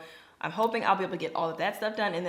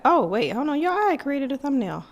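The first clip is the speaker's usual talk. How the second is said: higher, louder burst of realization; real sudden surprise; 9 semitones higher, 8 dB louder than usual. Real, not acted. Vlogger discovers she already made the thumbnail, surprised. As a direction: stop yourself with a pleased discovery.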